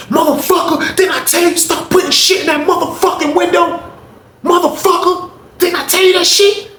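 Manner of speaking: high, full-throated cussing out; furious retold yelling. Honey bun man cussing out his cellmate, high furious yelling. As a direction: tear into the cellmate.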